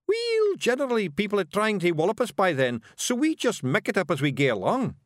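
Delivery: high, loud wee Scots warrior voice; Scottish Feegle Rob. A narrator's reading. Rob Anybody brags to Miss Level, high Scots Feegle. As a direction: play a cocky little Feegle, high and brash.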